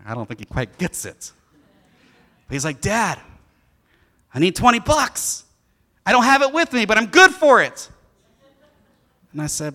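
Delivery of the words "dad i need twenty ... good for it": put-on higher and louder child's plea; imitating his son eagerly asking for money; eager kid asking. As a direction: promise it like a kid sure he's good for it. Father voices son asking money.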